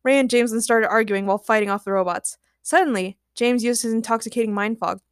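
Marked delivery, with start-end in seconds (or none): none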